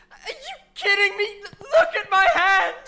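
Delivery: high and loud, near tears; distraught outcry. Acted. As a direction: cry out, showing the wound. Showing her own burned hand.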